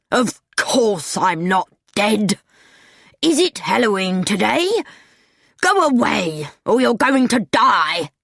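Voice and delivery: irritated character voice; Mister Nebbercracker, angry. A narrator's reading. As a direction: scold the kids and threaten them angrily. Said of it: "said Mister Nebbercracker angrily".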